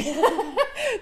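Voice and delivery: very high, loud cackling laugh; amused laugh. Real, not acted. Podcaster owns up to a long-winded story, laughing loudly. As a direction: burst out laughing at your own rambling.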